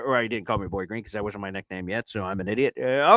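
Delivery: ordinary delivery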